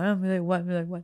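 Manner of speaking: lightly imitated tearful mumble; llanto retold. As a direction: brush it off as if weeping, only half acted. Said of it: "yo estaba llorando". (llorosa, imitándose sin fuerza)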